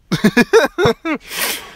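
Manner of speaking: a short high laugh that falls; real laughter at the joke. Real, not acted. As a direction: burst out laughing at his own joke. Joke about arrow to the ass.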